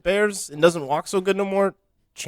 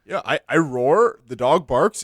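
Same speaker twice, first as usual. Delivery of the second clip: normal talking voice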